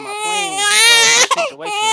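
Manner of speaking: real tears breaking through, pitch cracking high; choked up, fighting to get the words out; voice breaking with emotion. Real, not acted. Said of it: "You getting emotional?".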